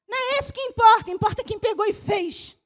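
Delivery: loud, driving sermon climax; fiery preaching. Real, not acted. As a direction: preach it hard to the church. Pastor preaching at full force. (pregação inflamada e forte)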